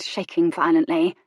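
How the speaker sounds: ordinary delivery, nothing marked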